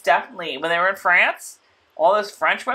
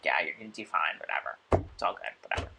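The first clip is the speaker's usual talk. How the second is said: mock-casual whisper, shrugging it off; offhand half-whispered reassurance; breathy whisper; 13 semitones lower, 14 dB quieter, faster than usual. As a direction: breathe it out, low and careless. Voicing Phaedra, whispered and dismissive.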